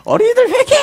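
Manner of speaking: very high, strained parody cry; comic high-pitched revival yell; mimicked preacher's shout. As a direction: parody a preacher calling for repentance. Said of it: "제 흉내를 내면서 막 낄낄대면서".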